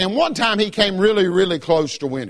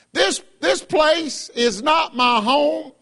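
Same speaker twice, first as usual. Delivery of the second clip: slow, shouted proclamation; preaching shout; 6 semitones higher, slower than usual. Real, not acted. Preacher declares to congregation, slow full-voiced shout. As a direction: cry it out slowly to the church.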